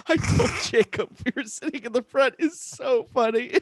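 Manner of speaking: high voice breaking up with laughter; laughing. Real, not acted. Repeating a friend's funny line, laughing through it. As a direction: talk through a real laugh.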